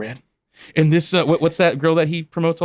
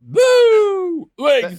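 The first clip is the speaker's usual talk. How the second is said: high shouted woo; Ric Flair woo; 5 dB louder than usual. Retold or imitated. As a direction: belt out a wrestler's woo. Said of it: "imitating the clip".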